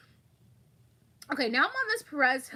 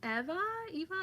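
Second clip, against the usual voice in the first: normal talking voice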